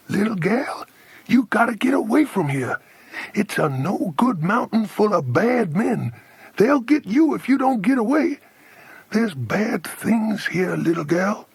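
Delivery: high-pitched, folksy American warning; American accent. A narrator's reading. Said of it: "He sounded like an American".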